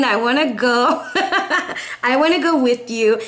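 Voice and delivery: giggling through the words; laughing. Real, not acted. Joking to viewers about joining their trip, laughing. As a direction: laugh while begging to come along. Laughing, wants to tag along.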